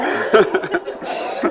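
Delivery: loud unvoiced laugh. Real, not acted. Brewer laughing after greeting the beer, loud laugh. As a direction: burst out laughing.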